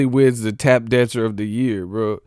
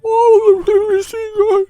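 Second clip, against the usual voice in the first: ghost impression; 6 dB louder than usual. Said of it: "why you sound like a ghost and shit?".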